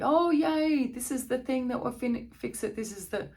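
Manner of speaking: high, excited mock cheer; hammed-up delight at a silver bullet; mock excitement. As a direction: gush over a miracle cure. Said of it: "the silver bullet".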